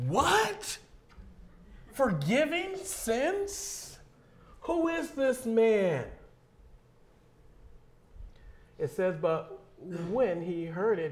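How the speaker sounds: loud, impassioned sermon voice; full-force preaching. Acted. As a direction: proclaim it to the whole hall.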